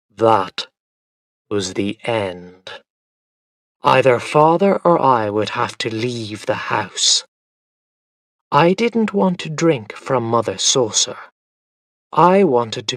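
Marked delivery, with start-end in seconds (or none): none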